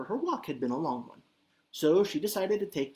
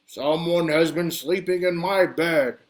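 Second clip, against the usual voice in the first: louder, slow big bear voice; thundering Papa Bear; 6 dB louder than usual. Acted. Papa Bear finds his pillow knocked down, thundering. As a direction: let it thunder like a huge bear. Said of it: "he thundered in his great big Papa Bear voice".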